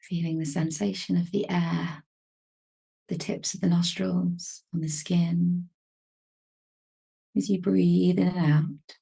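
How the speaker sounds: calm, unhurried meditation voice with long pauses; even, measured delivery, leaving space to breathe; guided meditation. Real, not acted. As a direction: gently guide them into stillness, phrase by phrase.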